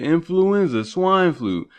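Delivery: normal talking voice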